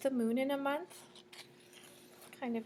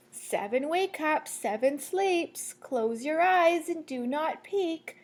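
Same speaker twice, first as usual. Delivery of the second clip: lively, rising read of the rhyme, fading at the end; reading to children; 4 semitones higher, 8 dB louder, slower than usual. Acted. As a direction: build up the list, then settle to sleep. Reads picture book aloud.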